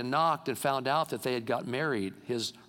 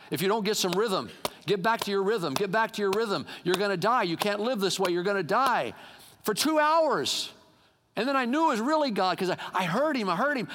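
higher-pitched, insistent repetition; urgent preached warning; 5 semitones higher than usual. Real, not acted. God warning the sleepless preacher for two hours, urgent preaching. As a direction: hammer the warning home.

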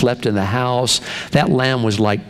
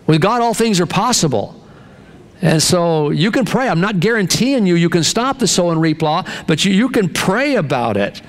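raised, pitched-up preaching; preaching at full force; 7 semitones higher than usual. Acted. Pastor exhorting his congregation, in a raised preaching voice. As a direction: drive the point home to the pews.

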